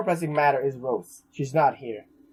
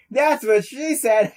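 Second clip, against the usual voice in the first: slow, higher, louder punchline; milk the silly joke; joking; 8 semitones higher, 4 dB louder, much slower than usual. Acted. Streamer cracks a joke mid-game, slow and louder.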